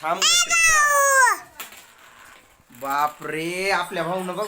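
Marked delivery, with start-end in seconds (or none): 0.0-1.3 high, loud, drawn-out call to stop. Real, not acted. Father calls out to stop his daughter Trisha. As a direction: call her back from across the room.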